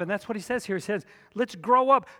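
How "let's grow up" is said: preaching at full force. Acted. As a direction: preach it out to the whole room.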